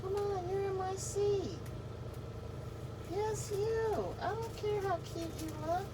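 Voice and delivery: high, soft coaxing pet voice; talking to a pet. Real, not acted. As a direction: sweet-talk the dog off the chair. Shooing pet from seat.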